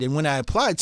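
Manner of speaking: normal talking voice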